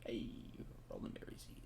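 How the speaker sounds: breathy whispered muttering; whispered aside. Real, not acted. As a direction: whisper it to yourself. Muttering aside during gameplay.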